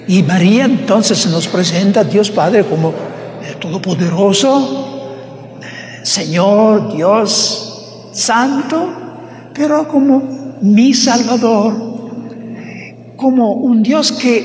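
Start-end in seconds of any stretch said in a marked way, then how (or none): none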